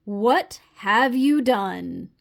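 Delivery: slow, word-by-word dismay; horrified demand. Acted. Frog finds gum in book, slow horror. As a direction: draw out each word, aghast.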